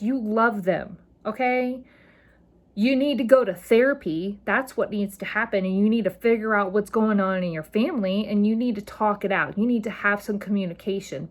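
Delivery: ordinary talk, nothing marked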